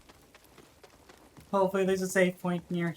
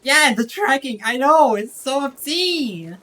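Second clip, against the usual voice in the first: exasperated rant; 5 semitones higher, 6 dB louder than usual. Real, not acted. Ranting about enemy tracking.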